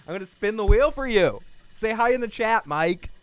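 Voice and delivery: game show host spinning the prize wheel; excited hype. Shown as a demonstration.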